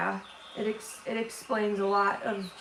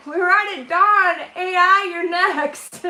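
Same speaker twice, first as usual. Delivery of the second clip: excited laughing; 8 semitones higher, 9 dB louder, slower than usual. Real, not acted. Joking threat to AI, laughing.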